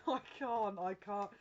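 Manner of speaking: high, loud burst through laughter, easing off; helpless with real laughter, giving up; trying not to laugh. Real, not acted. Reader cracking up at the message, helpless laughing. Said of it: "I'm trying not to laugh at this".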